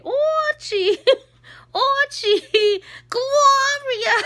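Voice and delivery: put-on very high, loud squawking accent; Edith Bunker. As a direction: wail the names in a hammed-up sitcom accent. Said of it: "she would say".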